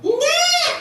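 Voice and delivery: put-on stubborn child refusal. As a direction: refuse like a pouting little kid.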